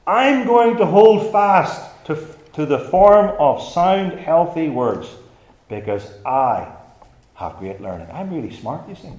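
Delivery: "I'm" and "I" leaned on boastfully, opening loud and high; stress on I. Acted. Preacher mimics a hypothetical boaster, stressing "I'm" and "I". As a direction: put self-important weight on every "I'm" and "I". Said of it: "when I emphasize a particular word".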